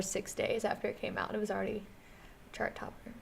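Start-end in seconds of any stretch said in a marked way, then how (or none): 2.5-3.1 soft unvoiced aside; whispering. Real, not acted. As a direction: breathe it out quietly. Dropped to a whisper.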